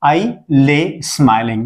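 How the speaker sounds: very slow, each word spelled out for students; slow teacher dictation; slow model answer. Shown as a demonstration. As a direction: say it slowly so the class can copy. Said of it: "teacher models English answer".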